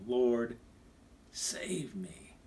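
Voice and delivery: "save me" breathed out in a whisper; a desperate little whisper of a prayer; whispered plea. Retold or imitated. Sinking Peter cries out.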